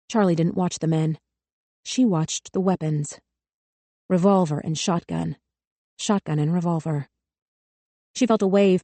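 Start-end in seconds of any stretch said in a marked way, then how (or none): none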